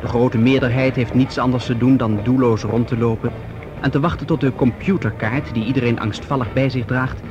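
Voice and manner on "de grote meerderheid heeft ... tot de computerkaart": usual speaking voice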